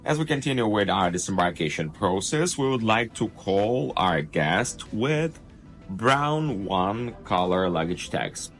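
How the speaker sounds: upbeat cruise director. Acted. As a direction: welcome the guests over the ship speakers. Ship PA announcement read.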